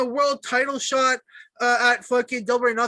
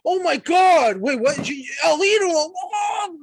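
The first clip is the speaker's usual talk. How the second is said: excited crowd; 7 semitones higher, 4 dB louder, slower than usual. Retold or imitated. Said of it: "they're like".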